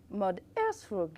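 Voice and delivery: slow, spaced earbud voice prompt; device voice prompt. Retold or imitated. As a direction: announce it like the earbud. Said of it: "une petite voix super qui vous dit".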